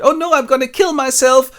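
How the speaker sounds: put-on high, loud teen wail; melodramatic Lydia. Lydia despairing over the militia, high and loud. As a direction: wail like a desperate teenager. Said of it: "Lydia is desperate".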